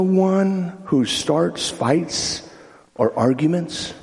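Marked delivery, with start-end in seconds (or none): none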